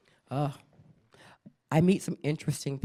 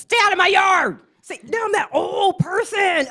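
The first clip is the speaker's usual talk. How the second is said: put-on high, loud old-lady shout; cranky yell; 8 dB louder than usual. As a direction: chase the kids off your yard. Yelling at neighborhood kids.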